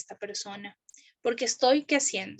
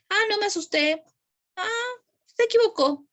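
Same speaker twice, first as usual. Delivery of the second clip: put-on higher, light child voice; lightly voiced child shrugging it off; child's light reply; 8 semitones higher than usual. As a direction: answer breezily like a small kid. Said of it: "le puedo preguntar".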